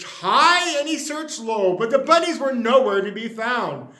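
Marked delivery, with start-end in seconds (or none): none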